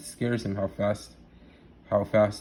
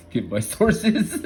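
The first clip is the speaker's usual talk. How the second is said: breaking into a laugh; 9 semitones higher, 6 dB louder than usual. Real, not acted. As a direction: laugh through the words. Laughs at own claim.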